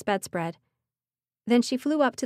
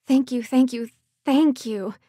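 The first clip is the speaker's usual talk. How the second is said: higher, louder repeated thanks; gushing thanks; 4 semitones higher, 5 dB louder than usual. Acted. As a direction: pour out gratitude.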